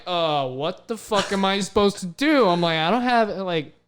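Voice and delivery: high, loud helpless outcry; flustered panic. Retold or imitated. Groped mascot to himself, loud flustered panic. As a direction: throw up your hands, can't believe it. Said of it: "I'm just like".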